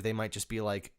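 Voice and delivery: normal talking voice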